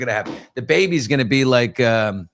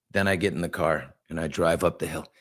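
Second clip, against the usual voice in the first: ordinary talk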